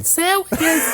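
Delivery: high ringing shout; shouting sandwiches. Pretend. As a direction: yell it like a sound drop. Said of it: "get a drop of you going".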